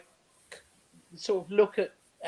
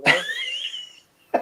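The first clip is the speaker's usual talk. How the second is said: mock drawn-out; 5 dB louder than usual. Jokey drawn-out word.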